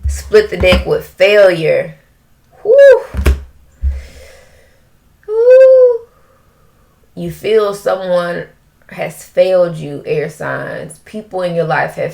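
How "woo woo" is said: high, loud woo; real thrilled yell; excited whoop. Real, not acted. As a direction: let out a joyful whoop. Ecstatic reaction to tarot card.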